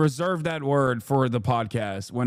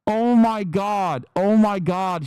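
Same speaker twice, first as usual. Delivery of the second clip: exasperated rant; 4 semitones higher, 5 dB louder, slower than usual. Acted. Ranting about his friend.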